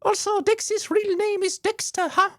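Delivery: very high squeaky sidekick; Paimon voice. Acted. Streamer voicing Paimon's question, very high and squeaky. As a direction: chirp it way up. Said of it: "that shitty-ass voice for Paimon".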